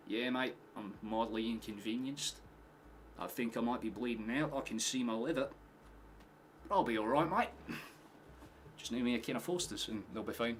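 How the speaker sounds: laid-back imitated Aussie; understated complaint in a put-on Australian accent. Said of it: "Australians just funny".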